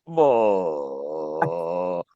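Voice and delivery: very low, slurred groan of a drugged man; stupor mumble. Retold or imitated. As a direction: drone it out half-conscious from the dentist gas. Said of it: "he is in a complete stupor".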